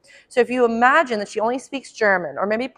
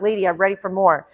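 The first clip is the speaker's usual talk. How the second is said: voicing the puppy; 2 semitones lower, faster than usual. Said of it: "she's like".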